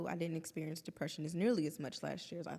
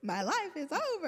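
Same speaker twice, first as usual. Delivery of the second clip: mock despair; 10 semitones higher, 3 dB louder than usual.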